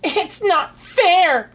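high, slow whiny wail; tantrum cry. Acted. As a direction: wail it like a spoiled child. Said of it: "cried the Grand Duke".

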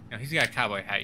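ordinary delivery

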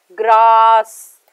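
Grass drawn out very slowly on a long, loud "aa"; stretched vowel. Shown as a demonstration. Teacher showing students a long stressed vowel in grass. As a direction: hold the a in grass long and big. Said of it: "stress out करके बोला जाता है".

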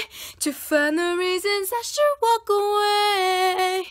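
sung line where the voice cracks; voice cracking. Real, not acted. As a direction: let the voice crack on the high note. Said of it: "Voice cracked".

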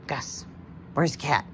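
ordinary talk, nothing marked

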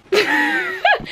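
short high giggle; quick amused laugh; light laugh. Real, not acted. Laughs at own trailing line.

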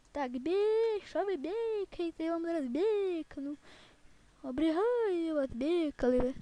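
high, loud, long drawn-out mock bleats; playful bleating. Player scolding the bleating animals back, high, drawn-out mock bleating. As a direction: tease the animals by stretching the words into loud bleats.

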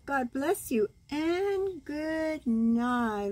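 ordinary delivery, nothing marked

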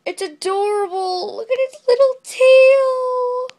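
high, loud, slow squeal of delight. Real, not acted. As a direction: gush over the toy. Squealing over the new toy.

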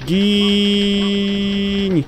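high, long-held single note; sustained battle cry. Acted. Player attacking an enemy that will not die, drawn-out battle cry. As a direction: hold the vowel out high and steady.

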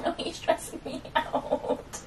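whispered, teary laugh through the words; crying laugh. Real, not acted. Telling viewers the book stressed her, crying and laughing. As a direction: laugh and cry at once, breathy whisper.

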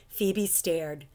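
plain reading voice

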